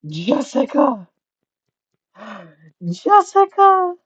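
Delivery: high, drawn-out excited call; delighted greeting. Real, not acted. Streamer spots a familiar viewer arriving, excited high call. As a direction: sing out the name in gleeful surprise.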